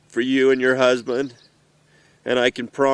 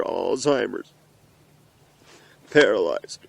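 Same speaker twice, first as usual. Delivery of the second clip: ordinary talk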